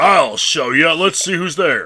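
macho man. Retold or imitated. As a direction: put on a show of macho confidence. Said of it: "being macho man that he is".

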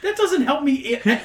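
normal talking voice

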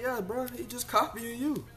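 high-pitched retelling. Retold or imitated. Host retelling what he tells a parent, voice jumping high. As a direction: squeal it up, playful and insistent. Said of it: "I'm like".

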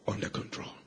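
near-whisper, barely voiced; hushed reassurance. The Lord calming the boy, hushed and assuring. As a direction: let it fall away to a hush.

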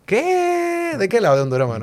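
sudden very high squeal of disbelief; you're kidding me; high jolt of surprise; shocked what. Real, not acted. Learns the other is from Honduras.

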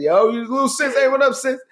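high, chummy hey sis; fake friendly greeting. A guy greeting his friend's girlfriend, mock friendly. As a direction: put on a fake buddy charm. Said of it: "smiling in his girlfriend's face".

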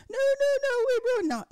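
very high, loud wail; mock panicked denial. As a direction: squeal it out, imitating terrified victims. Acting out the victims' panicked denial.

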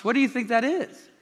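ordinary delivery

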